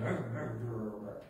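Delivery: soft growly mutter under his breath; grumbling mutter. Retold or imitated. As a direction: grumble the rrr quietly to yourself. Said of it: "heard him muttering something".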